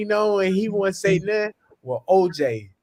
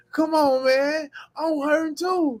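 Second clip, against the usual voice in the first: high, slow whiny plea; mock-pained high whine; imitating Reggie; 9 semitones higher, slower than usual. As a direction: pitch it up and drag it out like a worn-out teammate. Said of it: "my favorite saying from Reggie when he used to turn around and say".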